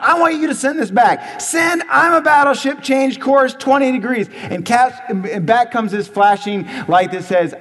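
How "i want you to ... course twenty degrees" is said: high, loud furious order; a captain losing his temper at the ship. Acted. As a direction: spit out the command in fury. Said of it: "He is furious. ... he spits out".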